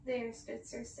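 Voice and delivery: usual speaking voice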